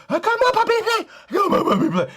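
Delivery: put-on very high, loud baby-talk squeal; squealing girl impression. Mocking the girl calling the dog to play, high squeal. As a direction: coax the puppy in a shrill squeal. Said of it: "And she".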